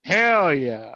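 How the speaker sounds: usual speaking voice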